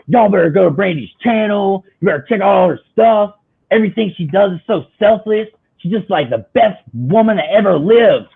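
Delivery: high, loud fan rant; gushing, worked-up telling-off; impassioned rant. Retold or imitated. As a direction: rave insistently, scolding anyone who won't subscribe. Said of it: "it was, like, a whole rant".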